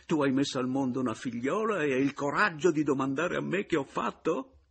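high, mounting outrage; indignant accusation. Acted. Husband throws wife's question back at her, outraged. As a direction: pile up the charges to a high incredulous question.

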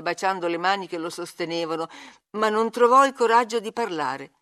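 usual speaking voice

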